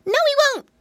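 very high, loud retort; high-pitched piggy boy yell; defiant shout. A narrator's reading. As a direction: shout it back high and sure. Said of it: "shouted Flop Ear".

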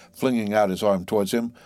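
plain reading voice

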